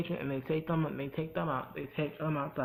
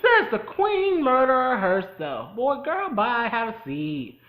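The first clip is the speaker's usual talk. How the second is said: higher, louder, slow drawn-out sass; sassy clapback; 8 semitones higher, 7 dB louder, slower than usual. Retold or imitated. Viewer mocking the butcher as a hypocrite, slow sassy higher shade. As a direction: throw shade with a slow diva flourish. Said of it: "And I'm like".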